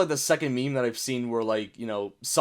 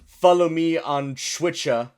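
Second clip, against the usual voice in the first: slow, higher mock-British delivery; British accent; 3 semitones higher, 5 dB louder, slower than usual. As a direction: exaggerate a British accent, drawing out the words. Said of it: "British people be like".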